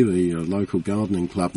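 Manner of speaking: ordinary delivery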